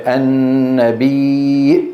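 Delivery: النبي drawn out slowly as Nafi' reads it; Nafi' reading. Shown as a demonstration. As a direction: recite النبي slowly, holding the long sounds. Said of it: "على قراءة نافع". (نطق «النبي» ممدودًا على قراءة نافع)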